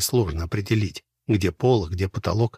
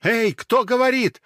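high, loud call; frantic yell back to the radio; desperate shout; 14 semitones higher, 4 dB louder than usual. A narrator's reading. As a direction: shout to make the voice hear.

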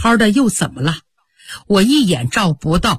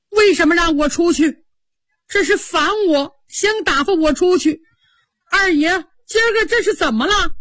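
tearful plea; 8 semitones higher than usual. A narrator's reading. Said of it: "他哭了".

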